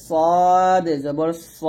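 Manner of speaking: সদ held very long, then heavy rounded স; drawn-out সদ, full "saw" on স; model recitation. Shown as a demonstration. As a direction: slowly intone সদ for learners. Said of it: "এরকমভাবে পড়বেন".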